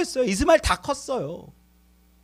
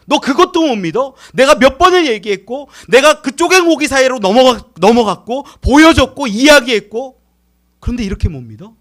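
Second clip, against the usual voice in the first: loud, higher frustrated outburst; exasperated God; 3 semitones higher, 9 dB louder than usual. Acted. Preacher voicing God's frustration at Sarah, loud exasperation. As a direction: vent like God fed up with doubt. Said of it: "제가 만약에 하나님이었으면요".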